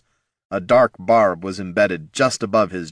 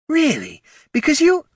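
lilting voice pitched way up; high teasing voice; 4 dB louder than usual. A narrator's reading. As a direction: tease him in a high voice. Genevieve mocking question.

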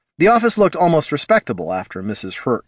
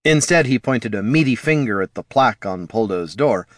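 ordinary delivery

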